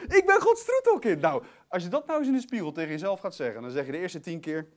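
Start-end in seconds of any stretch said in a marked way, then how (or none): 0.1-1.2 high, loud, joyful declaration; exultant shout. Real, not acted. Preacher celebrating being God's darling child, exultant shout. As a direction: proclaim it at full force, overjoyed.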